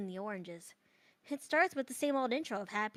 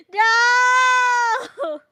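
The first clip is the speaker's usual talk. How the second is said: high, very loud drawn-out cry; long, high shouted protest; 11 semitones higher, 16 dB louder than usual. Real, not acted. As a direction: wail it out at the top of the voice. Protests after being teased over slip.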